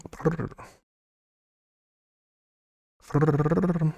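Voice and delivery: a cold shivery brrr; shivering brrr. Real, not acted. Shivering noise.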